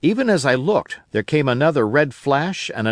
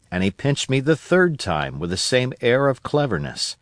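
ordinary delivery, nothing marked

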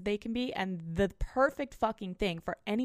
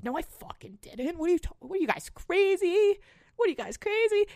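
higher, louder panicked protest; mock frantic denial; 9 semitones higher, 4 dB louder than usual. Younger self denying to her friends, hammed-up panic. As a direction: insist wildly that everyone's crazy.

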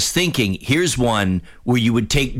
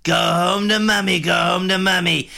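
higher mock Trump rally shout; Trump screaming; 7 semitones higher than usual. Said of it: "he's either screaming".

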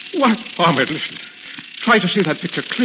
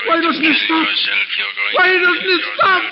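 panicked; 12 semitones higher, 3 dB louder than usual. Acted. Tormented man cracking under voice.

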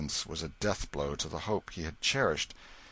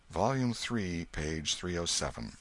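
ordinary delivery, nothing marked

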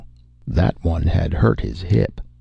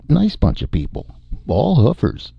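lightly voiced Hoagie; 8 semitones higher than usual. A narrator's reading. Hoagie muttering after the bus.

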